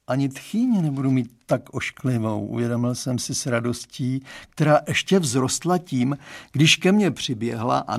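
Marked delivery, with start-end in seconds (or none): none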